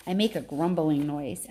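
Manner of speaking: usual speaking voice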